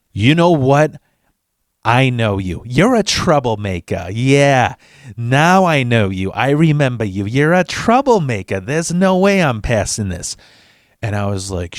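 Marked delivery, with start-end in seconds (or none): 0.1-10.3 put-on clerk's Italian-American accent, drawn-out accusation; put-on Italian-American accent, pointed recognition; accusing Italian-American lady's accent. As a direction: imitate an Italian-American clerk refusing firmly. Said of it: "in her, in her fucking act".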